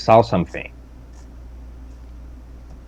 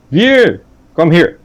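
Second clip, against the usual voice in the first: half dragon voice; 9 semitones higher, 7 dB louder, slower than usual. Acted. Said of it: "with my draconic voice".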